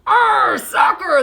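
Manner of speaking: rowdy crew cheer; crew yell. A narrator's reading. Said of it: "the crew yelled".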